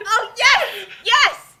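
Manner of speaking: loud, high, laughing yes; delighted shout. Real, not acted. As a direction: cheer it out gleefully. Excited at Mister Bacon news.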